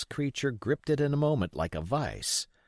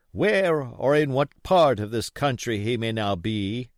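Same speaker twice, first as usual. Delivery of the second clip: odd sing-song beggar; 4 semitones higher, 6 dB louder than usual. A narrator's reading. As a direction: chant it like a pleading blind old sailor. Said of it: "raising his voice in an odd sing-song".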